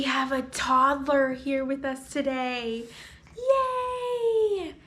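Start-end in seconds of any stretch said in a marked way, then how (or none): 3.4-4.7 very high, long-held yay; cheering. Real, not acted. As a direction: squeal a welcome cheer. Cheering the visiting toddler.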